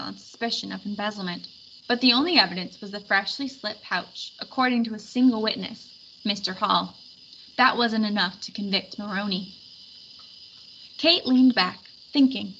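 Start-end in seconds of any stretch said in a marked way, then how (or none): none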